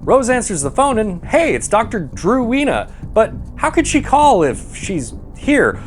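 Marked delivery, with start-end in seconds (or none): none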